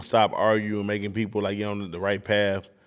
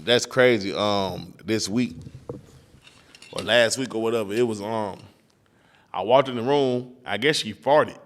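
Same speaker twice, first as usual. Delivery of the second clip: usual speaking voice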